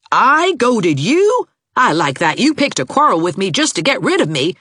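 high, loud quarrelling comeback; indignant retort. A narrator's reading. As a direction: hurl the accusation straight back.

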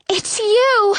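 high-pitched cry of recognition. A narrator's reading. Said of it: "she cries".

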